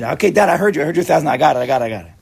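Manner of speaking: loud, high rush of protest; insistent, fed-up child talking over dad; exasperated kid. Acted. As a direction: play a kid desperate to end the lecture. Child wants dad to stop talking.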